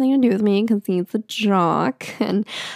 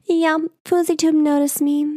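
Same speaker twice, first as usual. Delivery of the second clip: put-on bragging kid; 8 semitones higher than usual. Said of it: "I was like".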